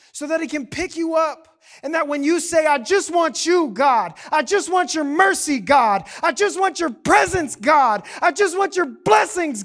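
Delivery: loud, rhythmic preaching build; impassioned preaching. Real, not acted. Pastor voices believers' plea to God, full-force preaching.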